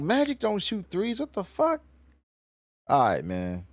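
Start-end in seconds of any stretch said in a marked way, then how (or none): 0.0-1.8 high, squeaky imitation of Emu in disbelief; mocking Emu. Streamer mimics Emu reacting to Luffy's attack, high and incredulous. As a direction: squeak it out like a baffled villain. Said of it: "And Emu was like".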